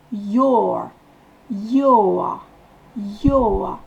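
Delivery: "Your" gliding from o to e; o-e vowel. Shown as a demonstration. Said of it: "On fait O E". ("Your" avec le son O E)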